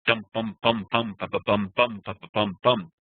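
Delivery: "bum, ba, ba, bum" as beats of long and short syllables; rhythmic nonsense-syllable beat, long bums, quick bas; dancing rhythm. Shown as a demonstration. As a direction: drum out the metre with "bum" and "ba". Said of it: "You hear the kind of dance".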